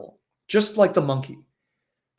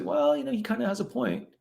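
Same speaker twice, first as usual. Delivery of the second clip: high, sweet little voice of doubt; a much higher, sugary inner voice nudging her; inner critic voice; 10 semitones higher than usual. Retold or imitated. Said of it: "that countervailing voice that says".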